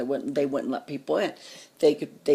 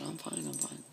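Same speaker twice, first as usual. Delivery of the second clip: low, very soft retold reply; quiet, nervous insistence; hushed reassurance; 10 semitones lower, 14 dB quieter than usual. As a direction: murmur it under the breath, low. Retold reply while scared.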